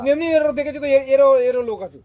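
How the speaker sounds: loud, heated retort; angry outburst. Acted. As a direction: fire back loudly in a quarrel. Heated quarrel, snapping back.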